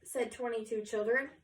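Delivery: usual speaking voice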